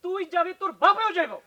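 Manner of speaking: husband threatening wife in a staged fight; angry threat. Acted. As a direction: lay down the law, furious. Couple quarrel escalating.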